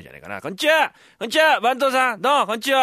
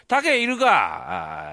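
slow call toward the house; slow drawn-out call; 3 semitones higher, much slower than usual. Acted. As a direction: call in from the doorway. Fool calls at friend's house.

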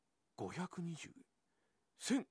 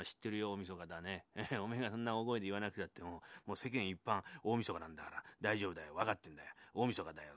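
grumbling husband; 5 semitones lower, 5 dB quieter than usual. Acted. Husband answers wife, lower and quieter.

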